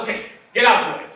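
loud, fast impatient dismissal; brisk voice of God. Acted. God dismissing failed leaders, loud and fast. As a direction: shoo them aside quickly. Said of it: "God said".

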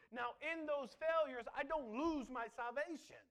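usual speaking voice

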